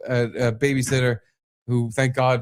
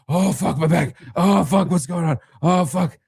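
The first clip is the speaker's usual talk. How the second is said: higher, hammed-up agony; pained panic; 5 semitones higher than usual. Husband retelling pacing the hallway, in mock agony. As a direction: re-enact moaning through back pain.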